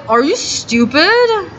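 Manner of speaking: can't-believe-it comeback; retold incredulous jab. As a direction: act out a drawn-out, scornful question.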